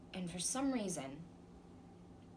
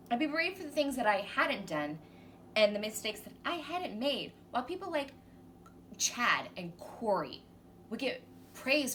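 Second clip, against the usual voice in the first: rising, louder outrage with stressed words; indignant rant; 7 semitones higher, 3 dB louder than usual. Acted. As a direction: build from loud protest into a high-pitched squeal of injustice. Ranting about stolen credit at work.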